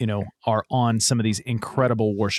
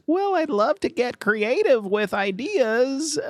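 put-on little old lady voice; 12 semitones higher than usual. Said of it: "the little old lady church voice".

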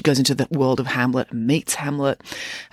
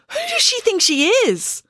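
put-on very high, indignant girl voice; outraged girl. A narrator's reading. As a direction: huff it as the furious daughter.

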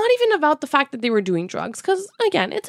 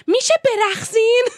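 much higher, fast mock yell at the idle crowd; retold scream; 10 semitones higher, 3 dB louder, much faster than usual. A girl yelling at people standing still to dance, fast high mock scream. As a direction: shriek it like the annoyed girl. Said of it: "one girl who literally screamed at them".

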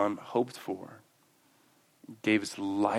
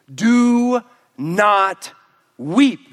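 a loud, high stern elder command; 10 semitones higher, 11 dB louder, much slower than usual. Retold or imitated. Elder commanding weeping John, a loud stern order. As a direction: give the order firmly. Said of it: "one of the elders said to me".

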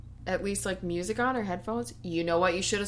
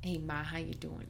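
put-on voice; 3 semitones lower, 13 dB quieter than usual. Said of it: "Don't do that".

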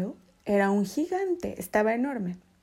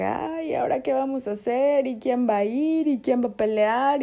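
panicked villagers; 5 semitones higher, 4 dB louder than usual. Acted. Frightened Israelites voiced.

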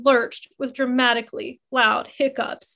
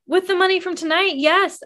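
confident declaring; 7 semitones higher than usual. Acted. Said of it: "I declared with slightly more confidence".

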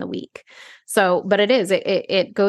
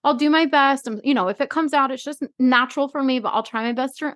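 high, gentle mock reassurance; overly sweet cop voice; mock soothing officer; 7 semitones higher than usual. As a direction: imitate the officer calming her. Mimicking officer.

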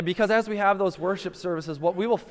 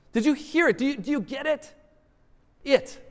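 excited; 7 semitones higher, faster than usual. Acted. Preacher pressing the congregation.

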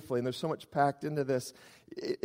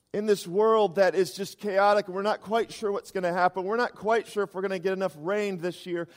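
normal talking voice

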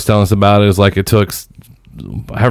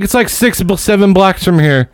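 much higher, casual vendor; lightly voiced vendor; 8 semitones higher than usual. Vendor giving directions, higher voice. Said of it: "She's like".

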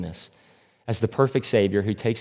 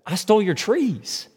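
higher, pleading Grinch voice; desperate Grinch; 11 semitones higher than usual. Retold or imitated. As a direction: plead it up high, getting desperate. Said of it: "And he says".

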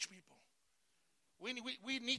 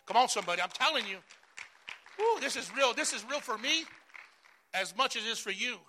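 fired-up preaching; 7 dB louder than usual. Real, not acted. Pastor rouses his church to believe, loud excited cry. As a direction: whip up the congregation.

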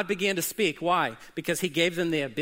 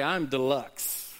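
mock boast; 5 semitones lower, much slower than usual. Shown as a demonstration. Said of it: "Look at your neighbor and say".

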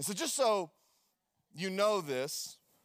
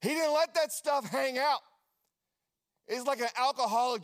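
preaching loud; 6 semitones higher, 4 dB louder than usual. Acted. Preaching emphasis.

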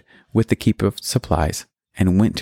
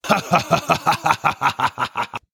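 booming villain laugh; 7 semitones higher than usual. Acted. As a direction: laugh like a gloating giant. Villain laughs before challenge.

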